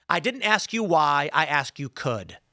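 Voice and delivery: why and could set apart, could pressed down; "I asked you could," leaning hard on could; stress on could. Retold or imitated. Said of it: "I never asked why they don't".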